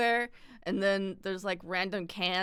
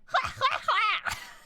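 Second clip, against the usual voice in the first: loud high cackle; bursting, high-pitched honking laugh; showing own laugh; 4 dB louder than usual. Shown as a demonstration. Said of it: "my laugh is like".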